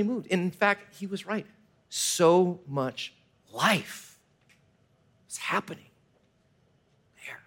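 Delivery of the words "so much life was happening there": hushed awe. Retold or imitated. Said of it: "like we said in that forest".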